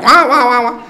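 loud, high cartoon honking babble; Charlie Brown wah-wah. Everyone talking around the couple, Charlie Brown wah-wah. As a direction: imitate cartoon grown-up chatter in wahs. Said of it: "You hear the Charlie Brown, like".

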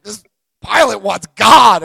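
loud, high, slow announcement; incredulous shout. Retold or imitated. Captain hears about the crying pilot, loud disbelief. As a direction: holler it in mock disbelief.

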